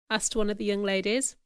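ordinary delivery

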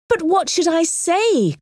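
high-pitched worried question; anxious cry. A narrator's reading. Harriet begs help answering a proposal, crying out anxiously in a high voice. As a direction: ask urgently, voice lifted high. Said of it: "cried Harriet anxiously".

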